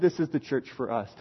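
very low, quiet mock dad voice; calm father. Dad answers his protesting son, low and quietly firm. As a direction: answer softly as the immovable dad.

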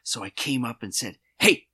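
clenched-jaw impression of a boss; words squeezed through tight teeth; through gritted teeth. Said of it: "I'm gonna speak through my teeth the way he speaks".

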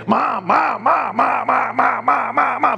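high whiny repeated mom, mom; childish nagging chant of mom. Retold or imitated. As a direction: pester like a four-year-old.

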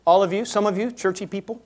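ordinary delivery

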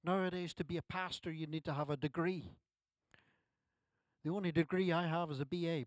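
ordinary delivery

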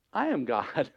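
quiet, settled declaration; calm divine authority. Acted. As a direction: answer quietly as God. God answering a human worry.

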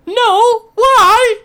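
put-on high, loud old lady squawk; old grandma voice. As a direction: chirp it back innocently. Grandma answering orc at door.

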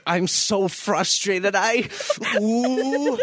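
high, slow rising mock frustration; Steve frustrated. Steve at the start of the sequence, high slow frustrated whine. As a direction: squeal it out like a fed-up kid. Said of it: "he's like".

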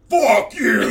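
high, loud monster bark; loud golem shout. Pretend. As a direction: yell it like an angry golem. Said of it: "there you go".

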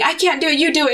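high, pleading; high panicked plea. Retold or imitated. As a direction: beg him to take the needle. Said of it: "I remember just saying".

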